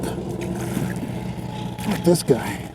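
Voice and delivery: slow breathy whisper; fishing whisper. Real, not acted. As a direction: whisper it, amazed. Quiet aside at big fish.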